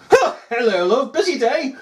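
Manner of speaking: high, sing-song domestic greeting; acted wife. Wife greets officer home for tea, high mock greeting. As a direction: greet him in a put-on high voice.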